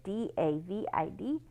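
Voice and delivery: ordinary delivery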